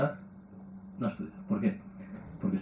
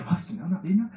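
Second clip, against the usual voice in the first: higher, sighing mock pity with a laugh; pitying mother; 7 semitones higher, faster than usual. Mother answering the father, higher and sighing. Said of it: "mother answering the father".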